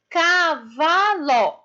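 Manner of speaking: "Cavalo" very slow, in three long beats CA, VA, LO; separated syllables. Shown as a demonstration. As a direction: break "Cavalo" into drawn-out, detached syllables. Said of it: "separar as sílabas".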